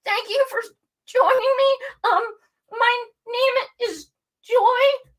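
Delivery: high, shaky, teary nervous intro; trembling nervous voice. Retold or imitated. Imitating her nervous first show greeting, high trembling voice. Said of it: "you can hear my voice like".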